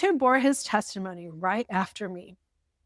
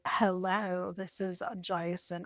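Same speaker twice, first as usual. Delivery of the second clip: slow, drawn-out bad Southern accent; mock a Southern drawl and fumble it, softer; a weak stab at a Southern accent, slow and trailing off; failed Forrest Gump Southern accent; 5 dB quieter, slower than usual. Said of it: "That was Forrest Gump".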